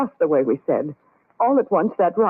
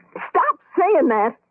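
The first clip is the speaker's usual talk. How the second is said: angry outburst; 7 semitones higher, much slower than usual. Acted. Frightened friends arguing over rock.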